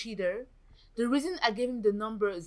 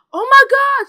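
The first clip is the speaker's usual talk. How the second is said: very loud, high shriek; high-pitched shock yell; alarmed scream; 17 dB louder than usual. Real, not acted. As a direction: cry out as disaster hits. Sim about to be shot.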